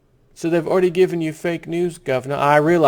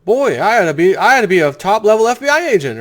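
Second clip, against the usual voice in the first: higher, louder mock self-praise; sarcastic brag; 7 semitones higher, 6 dB louder than usual. Real, not acted. Host mock-bragging to his listeners, sarcastic. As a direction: oversell your own genius ironically.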